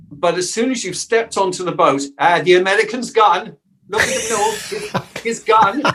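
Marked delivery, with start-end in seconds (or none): 2.2-5.8 higher, teasing imitation of the locals; mock dismissal. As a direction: write off the emigrant in mock outcry. Voices locals mocking the emigrant.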